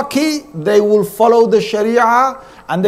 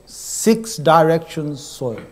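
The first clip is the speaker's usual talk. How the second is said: ordinary talk, nothing marked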